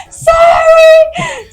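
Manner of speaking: high, loud crying apology; high, loud tearful "Sorry!"; sobbing shout. Retold or imitated. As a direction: wail the apology through tears. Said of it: "Chorando horrores".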